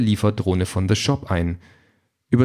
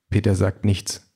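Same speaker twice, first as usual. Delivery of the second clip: ordinary delivery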